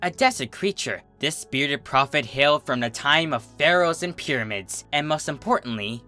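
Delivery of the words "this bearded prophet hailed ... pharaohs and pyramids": ordinary delivery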